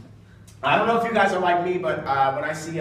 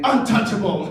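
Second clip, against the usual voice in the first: Halo game announcer; 5 semitones higher, 5 dB louder than usual. Acted. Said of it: "The Halo Boys comes on again".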